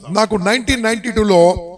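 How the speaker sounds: ordinary talk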